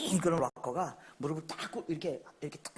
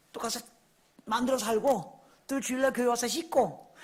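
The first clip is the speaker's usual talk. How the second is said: ordinary talk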